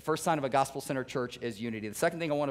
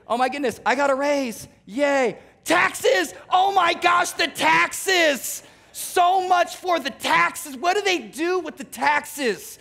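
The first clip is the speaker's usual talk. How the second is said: much higher, shouting, then slow wailing; mock tax complaint; 11 semitones higher, 7 dB louder, slower than usual. Worker seeing taxes on a raise, hammed-up wailing. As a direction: wail over the taxes like a tragedy. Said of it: "This is what I hear".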